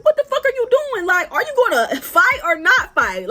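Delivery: high-pitched, exasperated demand; incredulous challenge. Retold or imitated. Watching her circle without swinging, incredulous challenge. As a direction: press her in disbelief, pitch jumping up.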